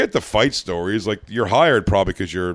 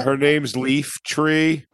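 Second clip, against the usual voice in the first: slow mock naming; 4 semitones higher, much slower than usual. Joking slow fake names.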